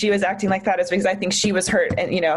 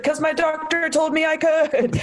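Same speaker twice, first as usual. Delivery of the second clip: high, slow, drawn-out protest; defensive retort; 7 semitones higher than usual. Retold or imitated. Victim answering the blaming detective, defensively. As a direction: stretch it out in exasperated self-defence.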